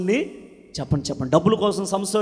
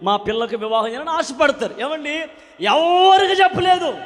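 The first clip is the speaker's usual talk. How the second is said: loud, high, ringing preacher's cry; fervent revival preaching, loud and lifted; full-force preaching; 3 semitones higher, 7 dB louder than usual. Acted. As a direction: preach it out to the whole hall.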